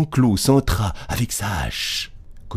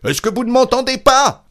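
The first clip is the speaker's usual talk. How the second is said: very high, loud exasperated question; full-force shout; 6 dB louder than usual. A narrator's reading. Innkeeper to unresponsive old woman, shouting. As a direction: yell to get through to deaf ears. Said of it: "cria-t-il de toutes ses forces".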